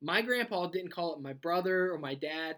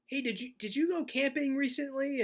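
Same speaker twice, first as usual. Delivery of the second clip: higher, put-on stranger voice; disguised voice; 8 semitones higher than usual. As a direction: keep the fake voice going, a bit high. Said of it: "with a disguised voice".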